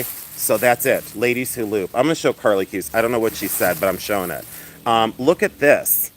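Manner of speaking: usual speaking voice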